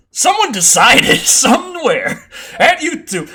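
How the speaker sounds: slow words broken by laughter; laughing. Real, not acted. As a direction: laugh through the complaint. Laughs mid-sentence.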